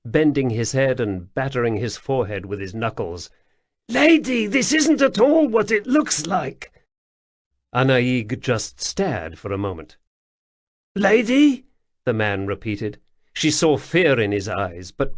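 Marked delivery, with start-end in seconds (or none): 3.9-6.7 high, loud jittery stranger; pleading, flustered little man; nervous odd man voice. A narrator's reading. Voices frightened stranger Wemreddle. 10.9-11.7 high, loud jittery stranger; pleading, flustered little man; nervous odd man voice. A narrator's reading. Wemreddle repeats himself.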